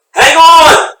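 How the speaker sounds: sharp yell; shouting. Acted. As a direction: shout the warning. Rescue shout.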